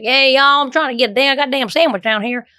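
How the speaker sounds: a hint of a Texan wanting a sandwich; Texas accent. Imitating a Texan at the deli, a light Texas accent. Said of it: "people in, like, Texas".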